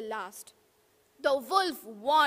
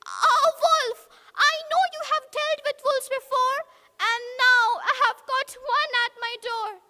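much higher, fast pig; panicked little pig; 9 semitones higher, 5 dB louder, faster than usual. Acted. Pig telling of the wolf at the door, high and fast. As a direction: gabble it out high and quick.

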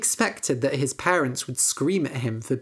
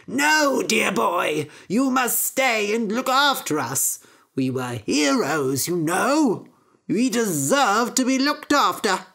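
higher, a bit louder pleading parents; doting parents crying out; 5 semitones higher, 4 dB louder than usual. Acted. Parents pleading with their son Jack, raised voice. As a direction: beg their son to stay, pitched up. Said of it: "his parents cried".

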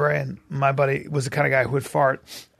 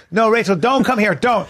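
urgent warning; 7 semitones higher, 4 dB louder than usual. Retold or imitated. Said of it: "I go".

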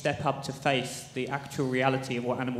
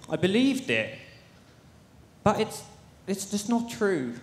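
upset, higher-pitched and halting; emotional, pausing as if struggling to go on; real, shaken emotion; 5 semitones higher than usual. Real, not acted. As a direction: let the hurt lift your pitch. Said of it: "I'm shaking right now".

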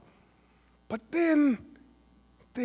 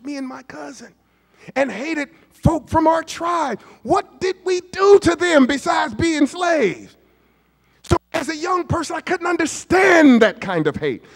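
rising preacher shout; sermon climbing to a shout; preaching build; 8 semitones higher than usual. Acted. Preaching at full force.

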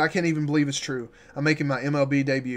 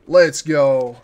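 a big celebratory yell; loud cheer; 9 dB louder than usual. Real, not acted. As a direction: shout it out, excited. Streamer celebrating call-up.

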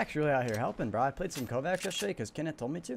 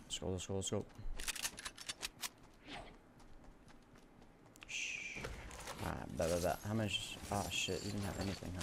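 hushed; 6 semitones lower, 13 dB quieter than usual. Real, not acted. Sneaking in game.

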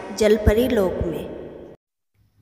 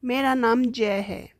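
lightly voiced young prince; 3 semitones lower than usual. Rescued prince speaks to mermaid.

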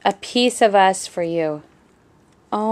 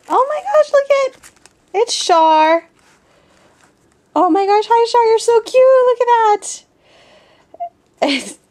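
much higher, much louder squealing; delighted squeal; 9 dB louder than usual. Real, not acted. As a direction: squeal with joy at the cute surprise. Sees her head on dress form.

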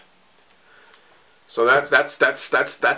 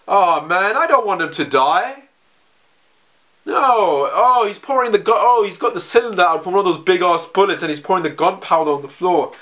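alarmed cry of "oh no", much higher and louder; upset; 8 semitones higher, 3 dB louder than usual. Real, not acted. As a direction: blurt it out in real dismay as the scene unfolds. Dreading a character's death.

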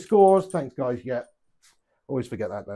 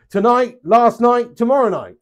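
slow, louder, each night hit; emphatic list; 7 semitones higher, 9 dB louder than usual. Real, not acted. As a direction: spell out every night. Joking list of nights to a viewer.

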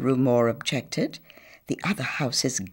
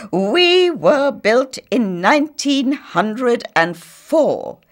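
higher, slow, loud declaration; loud interruption; 7 semitones higher, 7 dB louder, slower than usual. A narrator's reading. Two houses interrupting Missus Millefleur, loud and slow. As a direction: talk over her together. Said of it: "interrupted loudly".

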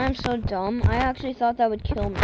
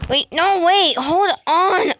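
high urgent yelling; alarmed shout; 5 semitones higher, 7 dB louder, slower than usual. Real, not acted. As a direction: shout to stop it now. Sudden alarm in game.

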